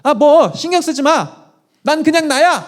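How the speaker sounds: mock a young woman waving off her mother; dismissive sister-in-law. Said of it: "그러니까 처제가 이랬어요".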